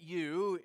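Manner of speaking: single out "you" with extra force; emphatic "you"; stress on you. Shown as a demonstration. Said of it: "in the Greek is emphatic".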